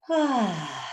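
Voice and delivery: loud open-mouthed sigh out; big release sigh; dramatic sigh. Shown as a demonstration. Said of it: "making that nice sigh of".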